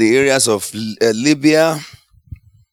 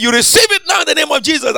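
commanding shout; faster than usual. Retold or imitated. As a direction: shout the healing command with force. Preacher retells healing command.

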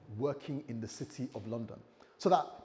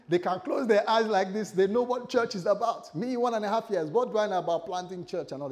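loud, higher, pleading excuses; louder and higher as the excuses pile up; worked-up protest; 5 semitones higher, 6 dB louder than usual. Retold or imitated. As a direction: argue your way out of it, climbing. Protesting God's call.

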